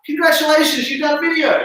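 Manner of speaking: high, loud cheer; mock celebration. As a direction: cheer them on loudly.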